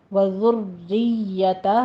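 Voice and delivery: وَذُرِّيَّتَهَا with heavy doubling and a small stop; وَذُرِّيَّتَهَا slow, leaning on the doubled ya; slow model of وَذُرِّيَّتَهَا; tajweed pronunciation. Shown as a demonstration. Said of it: "تھوڑا سا رک کے پھر آگے جائیے گا".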